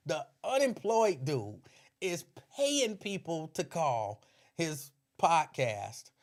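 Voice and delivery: ordinary delivery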